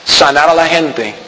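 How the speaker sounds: ordinary talk, nothing marked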